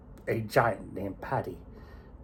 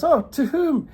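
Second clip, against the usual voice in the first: high surprised giant; 8 dB louder, slower than usual. Acted. Paddy surprised by Katie's answer.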